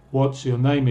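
"What's" said as one joined word; everyday joined-up "What's your name"; contracted WHAT'S. Shown as a demonstration. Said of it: "es la pronunciación".

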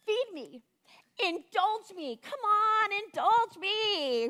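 high whiny tempter voice; flesh tempting. Acted. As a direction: coax and nag like a spoiled temptress, slowing on indulge. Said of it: "She says".

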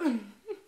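loud breathless laugh; breathy laugh. Real, not acted.

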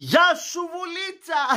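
very high-pitched gleeful call; excited, sing-out shout of appreciation; delighted cheer. Real, not acted. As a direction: cheer the guest on in a high squeal. Host cheers the guest afterwards.

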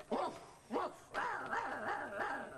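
imitated woofs and growls; dog barking. Puppeteer playing the dog in the show, barking.

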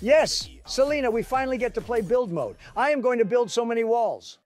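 high, thrilled shouting; excited. Acted. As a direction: bounce with glee over walls. Donald thrilled about build mode.

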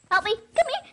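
high desperate plea; crying for help. Real, not acted. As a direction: beg for help in panic. Dying, calling for help.

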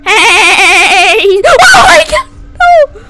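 wavering loud cry into high shrieks; terrified screaming. Real, not acted. Player attacked in the game, terrified screaming. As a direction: scream in real panic.

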